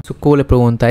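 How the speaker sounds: ordinary delivery, nothing marked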